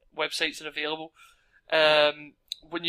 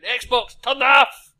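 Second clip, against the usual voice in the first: loud yell, much higher; shouting; 9 semitones higher, 13 dB louder, slower than usual. Retold or imitated. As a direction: bark the command at the console. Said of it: "I just imagine me shouting".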